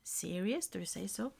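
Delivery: trailing into a soft half-whisper; mumbling. Real, not acted. Searching for the English word, mumbling. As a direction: mumble it quietly, unsure of the word. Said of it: "I'm mumbling".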